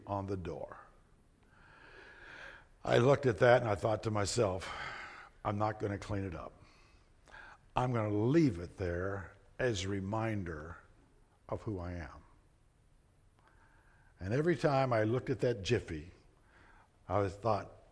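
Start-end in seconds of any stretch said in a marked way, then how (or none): 5.4-12.2 hushed, muttered to himself; a low private vow; quiet inner resolve. Retold or imitated. Preacher recalling vow to himself, hushed and low. Retold private thought after anger.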